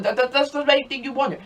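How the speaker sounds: ordinary talk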